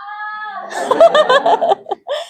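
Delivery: high burst of laughter; real laugh. Real, not acted. Teacher after asking a pupil to scream, laughing. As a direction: break into high, amused laughter.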